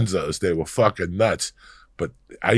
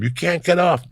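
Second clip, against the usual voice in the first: mock yell; 10 semitones higher than usual. Tour guide yelling at tourists.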